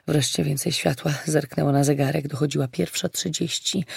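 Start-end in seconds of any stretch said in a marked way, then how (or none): none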